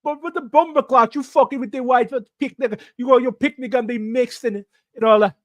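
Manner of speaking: high, loud Jamaican patois rant; hammed-up, high-pitched Jamaican scolding; mock Jamaican accent. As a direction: rail in a put-on Jamaican accent. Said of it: "that Jamaican kiss their teeth thing".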